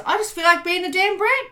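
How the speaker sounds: high, bratty whine; sulky petulant child; bratty inner voice. Pretend. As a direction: voice the spoiled inner brat. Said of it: "the part of you says".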